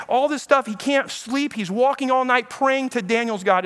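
usual speaking voice

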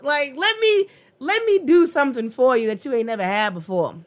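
high, loud, insistent suitor; acted wooing, voice leaping up; pleading gentleman. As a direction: plead your case as a devoted lover. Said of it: "That's gentleman".

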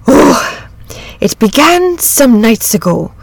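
rattled rustic storyteller; troubled shepherd. A narrator's reading. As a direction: begin a worrying tale in a shepherd's voice.

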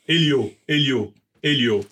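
Elliot said French-style as Elio, silent T; French mispronunciation. Retold or imitated. Imitating everybody in France misreading his surname, French pronunciation. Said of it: "in French, you know, everybody".

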